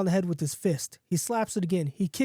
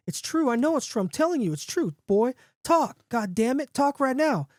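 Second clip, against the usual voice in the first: high, insistent, building to a demand; plead, then order with rising impatience; growing impatience; 6 semitones higher than usual. A narrator's reading. Man to the dog as the crowd taunts, increasingly impatient. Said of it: "the man starts growing more impatient".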